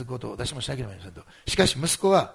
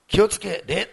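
retold command; 7 semitones higher, 7 dB louder, slower than usual. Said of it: "と号令を息子がかけて".

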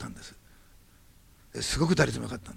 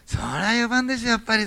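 emphatic insistence; 7 semitones higher, 6 dB louder than usual. Retold or imitated. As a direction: insist like it is obvious. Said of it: "思わず言ったんですよ".

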